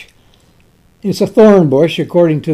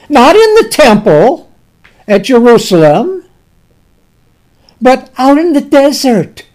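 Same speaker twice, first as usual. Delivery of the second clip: raised, louder preaching voice; preaching at full force; 5 semitones higher, 4 dB louder than usual. Acted. Preacher telling the congregation it was out in the desert, raised and ringing. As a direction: proclaim it to the congregation.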